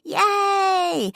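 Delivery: high, loud joyful scream; glass-shattering kid's cheer; child's scream. A narrator's reading. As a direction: scream it like a thrilled little girl. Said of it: "she screamed".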